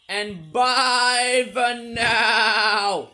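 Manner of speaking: loud, drawn-out mock sheep bleat; stretched-out, hammy sheep goodbye; bad sheep impression. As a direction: bleat it out long and wavering like a badly faked sheep. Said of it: "that was a very bad sheep impression".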